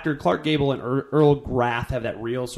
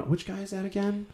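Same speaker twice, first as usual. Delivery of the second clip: quoting a puzzled viewer, higher and soft; confused moviegoer; 6 semitones higher, 8 dB quieter than usual. Retold or imitated. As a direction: ask it like a lost viewer. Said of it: "like, going".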